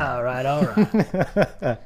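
slow laid-back drawl; slow it down, easy; slow lazy drawl; Wooderson imitation. Echoes the quote.

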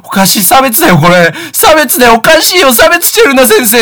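high, loud protest, pitch climbing; mock indignant kid shouting; retold shouting. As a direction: keep yelling discrimination at the teacher. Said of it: "もう大きな声で言い始めるわけよ".

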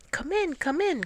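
Dog calling out. A narrator's reading. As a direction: call out a cheery welcome as Dog. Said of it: "called Dog".

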